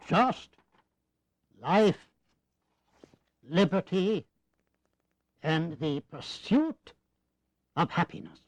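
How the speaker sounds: each word set apart by long pauses; slow pointed delivery, pausing between life, liberty, and happiness; slow demonstrative phrasing. Acted. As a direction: let life, liberty, and the pursuit of happiness fall one by one. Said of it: "Much more elegant".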